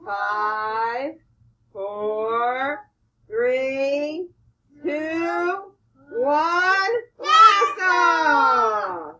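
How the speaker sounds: countdown building to a shout. Shown as a demonstration. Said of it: "let's count backwards from five".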